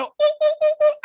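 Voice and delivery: squealing parrot hoots, very high; parrot squawk. Retold or imitated. Mocking her like a rainforest parrot, very high. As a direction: squawk like a jungle bird. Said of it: "She sound like every goddamn parrot".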